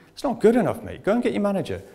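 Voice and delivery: high, hammed-up indignation; indignant complaint. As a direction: insist the manager come. Demanding the manager.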